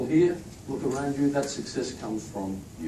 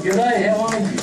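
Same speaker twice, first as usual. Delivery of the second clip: loud, high Aussie country g'day; big Australian welcome called out; 8 semitones higher, 8 dB louder than usual. Acted. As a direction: greet like a hearty country local. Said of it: "you get that country welcome".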